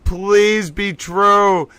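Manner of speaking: very slow "please be true", stretching every word; drawn-out plea. Real, not acted. Streamer pleading that the rumor is true, drawn out slowly. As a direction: beg it out long and slow.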